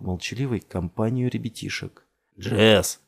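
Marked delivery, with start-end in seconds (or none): 2.4-3.0 loud shout of a name; loud hail without raised pitch; distant shout. A narrator's reading. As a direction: call out to someone far away. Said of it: "закричал откуда-то издалека Фишборн".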